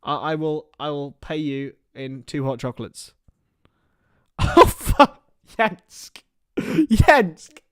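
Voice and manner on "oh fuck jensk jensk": high startled curse, half breath; startled gasping oh; shocked outburst. Real, not acted. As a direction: react like you just saw something dodgy. Shocked at a chat message.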